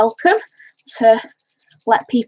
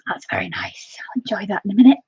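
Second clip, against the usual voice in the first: whispering. Real, not acted. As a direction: whisper it to the viewers. Whispering about the coffee.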